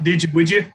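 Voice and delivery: "did you, would you" slurred into "didja, wouldja"; Southern run-together. Acted. Teasing a coworker about his truck with slurred "didja wouldja". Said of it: "we tend to run all of our words together".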